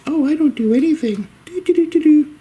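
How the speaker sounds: very high innocent sing-song cat voice. Retold or imitated. Owner voicing the cat pretending innocence, high sing-song. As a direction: play the cat acting innocent, high and light. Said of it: "he just".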